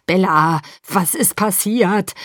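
worried father calling out, drawn out; restrained, stretched-out shout. A narrator's reading. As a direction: call slowly through a closed door. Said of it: "schrie Charlie".

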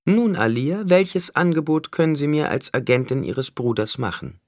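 high-pitched villain voice; bargaining Skitale, taunting. A narrator's reading. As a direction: speak high, pressing a deal. (hohe Figurenstimme, feilschend)

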